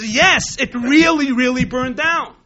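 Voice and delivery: "really, really" hit loud and high; emphatic insistence. Retold or imitated. Manager convincing the miser, loud insistence on "really, really". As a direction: punch "Yes" and each "really".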